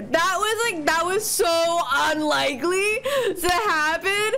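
high, drawn-out celebration; giddy disbelief rising to a cheer; jubilant. Real, not acted. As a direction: proclaim a miracle, stretching the words. Celebrating an unlikely lucky hatch.

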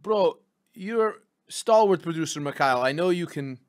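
put-on Russian accent, broken English. Host urging his stalwart producer into the bit, Russian accent. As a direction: coax your partner in a Russian accent. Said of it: "we do accents for whole first bit".

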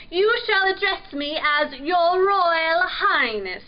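high, loud royal demand; haughty prince. A narrator's reading. Prince correcting the pirate on his title, haughty. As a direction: pull rank imperiously.

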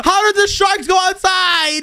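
high, drawn-out disbelief; alarmed outcry. Acted. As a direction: cry out in mock alarm. Dad alarmed at escaped sharks.

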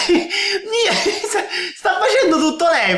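laughs through the words; can't keep a straight face; laughing. Real, not acted. Reacting to the clip, laughing helplessly.

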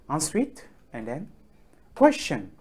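normal talking voice

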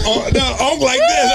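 normal talking voice

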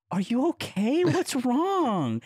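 high, tender mock concern; concerned comforting. Retelling checking on a crying friend, high concerned voice. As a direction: coo it high, like checking on someone upset.

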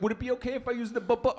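quick, high question that jams on "bu-"; stutter. Retold or imitated. Comic mimics the client asking for the bathroom, stuttering. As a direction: imitate a stammerer stuck before bathroom. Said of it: "someone has a messed up stutter".

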